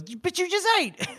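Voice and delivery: high-pitched squawk of disbelief; hammed-up high outburst; mock exasperation. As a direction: squeal it in comic protest. Retold exasperated reply to hunger.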